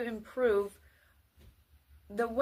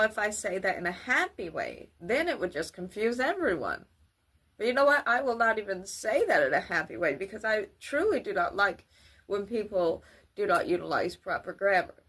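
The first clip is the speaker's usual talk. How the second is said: ordinary talk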